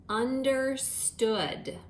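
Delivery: understood, stretched very slowly; slow dictation. Shown as a demonstration. Teacher dictating understood to second graders, very slow. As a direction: draw out understood for the class. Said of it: "compound word that you're going to write is".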